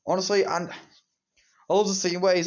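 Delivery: normal talking voice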